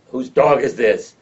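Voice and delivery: loud, slow yell across the cafeteria. Retold or imitated.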